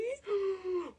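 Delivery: soft scared gasp; a hushed, playful fright for the listener; mock gasp. Reacts to scary mouse.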